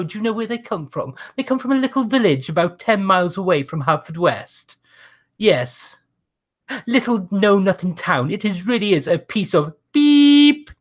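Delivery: high, haughty, indignant speechmaker; 19th century orator. Acted. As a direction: scold the crowd like a snob lord. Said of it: "Lord Monet answers booing crowd".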